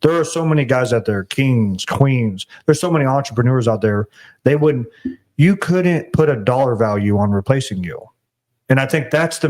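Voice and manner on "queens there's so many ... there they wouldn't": ordinary talk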